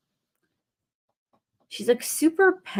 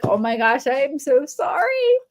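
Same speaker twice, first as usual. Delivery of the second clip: embarrassed apology; 6 semitones higher, 4 dB louder than usual. Retold or imitated. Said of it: "I'm like".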